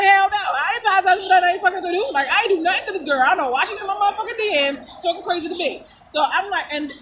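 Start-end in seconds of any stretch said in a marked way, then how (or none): 0.0-5.8 imitating her own angry reply; heated retort. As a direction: fire it back, outraged.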